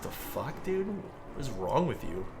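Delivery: hushed, half-whispered disgust; quiet disbelief. Retold or imitated. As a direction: mutter it, appalled. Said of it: "It's like".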